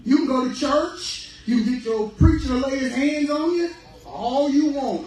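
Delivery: loud, high preaching build; full-force sermon call; fiery preaching. Real, not acted. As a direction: preach it out to the congregation.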